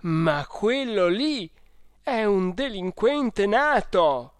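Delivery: high, loud, slow outraged outburst; furious cry at the boy; indignant exclamation. A narrator's reading. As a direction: explode with indignation. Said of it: "esclamò al colmo dello sdegno".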